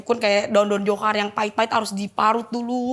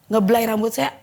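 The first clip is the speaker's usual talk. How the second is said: normal talking voice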